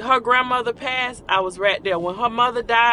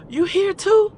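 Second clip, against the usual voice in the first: put-on a happy, startled question to an old friend; mild retold surprise at meeting her there; surprised; 10 semitones higher than usual.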